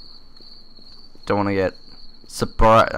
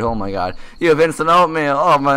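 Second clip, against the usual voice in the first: normal talking voice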